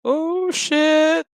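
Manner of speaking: high, loud comic alarm; drawn-out high-pitched oh-no; mock "oh shit" panic. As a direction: squeal it in exaggerated dismay. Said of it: "That was a, "Oh, shit, I just shit myself" face".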